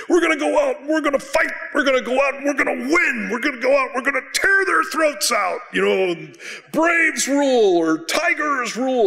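high, loud locker room rallying cry; hammed-up coach pep talk. Coach rallying the football team before the game, hammed-up fired-up shout. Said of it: "The coach would say".

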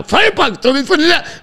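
the big moment, shot flies at the net; excited shot call. Real, not acted. Commentator calling a shot at the net, very excited.

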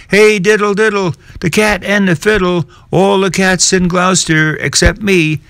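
high, sulky cat voice. Acted. Simpkin the cat complaining he is left out, high. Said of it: "said Simpkin".

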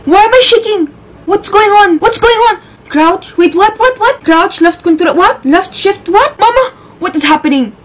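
high-pitched panicked yelps; panicking. Real, not acted. Player lost in horror game controls, panicking. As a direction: cry out in rapid, high bewilderment. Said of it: "I am panicking".

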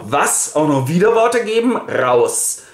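an outraged headmaster throws a pupil out; indignant outburst. Retold or imitated. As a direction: explode at the cheeky pupil. Headmaster expels Melchior.

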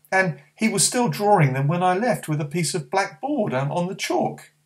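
put-on high, eager boy's voice; young boy calling to his friend; young boy Barney. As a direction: speak up as a small boy.

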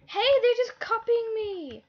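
high, loud, drawn-out protest; loud, slow, high-pitched indignation; indignant whine. Real, not acted. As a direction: call out a stretched, offended complaint. Indignant at other players copying.

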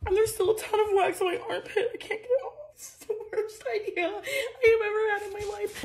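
high, soft, laughing whimper; giggling through despair; real laugh-cry. Real, not acted. Laughing while stuck with wax.

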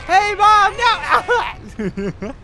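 high loud squeak of a small fish; squeaky fish voice. Retold or imitated. Gamer voicing a fleeing fish calling mom, high squeak. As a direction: voice a panicked little fish. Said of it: "He's like".

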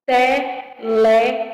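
"Televisão" loud in spaced beats, te-le-vi-são; syllable by syllable. Shown as a demonstration. Teacher showing pupils four syllables, syllable by syllable. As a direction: punch out each syllable of "Televisão". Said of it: "Quatro sílabas".

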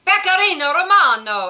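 exaggerated Italian pronunciation of Pecorino Romano; Italian accent. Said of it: "How do you like my Italian accent?".

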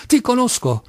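high-pitched angry exclamation; irate. A narrator's reading. Clerk shakes his fist at Kolia, irate. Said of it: "esclamava in tono iroso".

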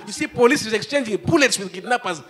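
ordinary talk

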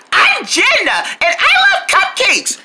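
very high, girly mimicry; Jenna. [name] imitates a friend to her face, very high voice. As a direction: squeal it high, drawing it out. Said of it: "I can do your voice, too".

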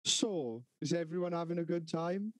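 breezy mock small talk; reset chirpy host. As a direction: imitate a drunk suddenly acting sociable. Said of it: "then just be like".

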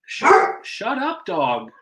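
shouting. Real, not acted. Yells at barking dog.

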